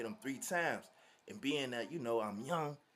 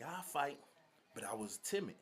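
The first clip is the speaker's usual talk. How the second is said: ordinary delivery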